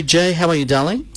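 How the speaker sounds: ordinary talk, nothing marked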